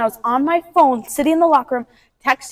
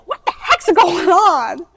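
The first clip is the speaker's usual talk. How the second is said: drawn-out, higher, laughing disbelief; baffled; 4 semitones higher, much slower than usual. Retold or imitated. Friend seeing three missed calls from Ava, baffled. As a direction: stretch it out, amused and bewildered.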